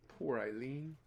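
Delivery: plain reading voice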